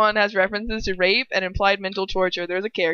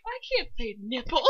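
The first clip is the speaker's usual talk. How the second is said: giggly quote; 5 semitones higher, 5 dB quieter than usual. Retold or imitated. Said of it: "I was like".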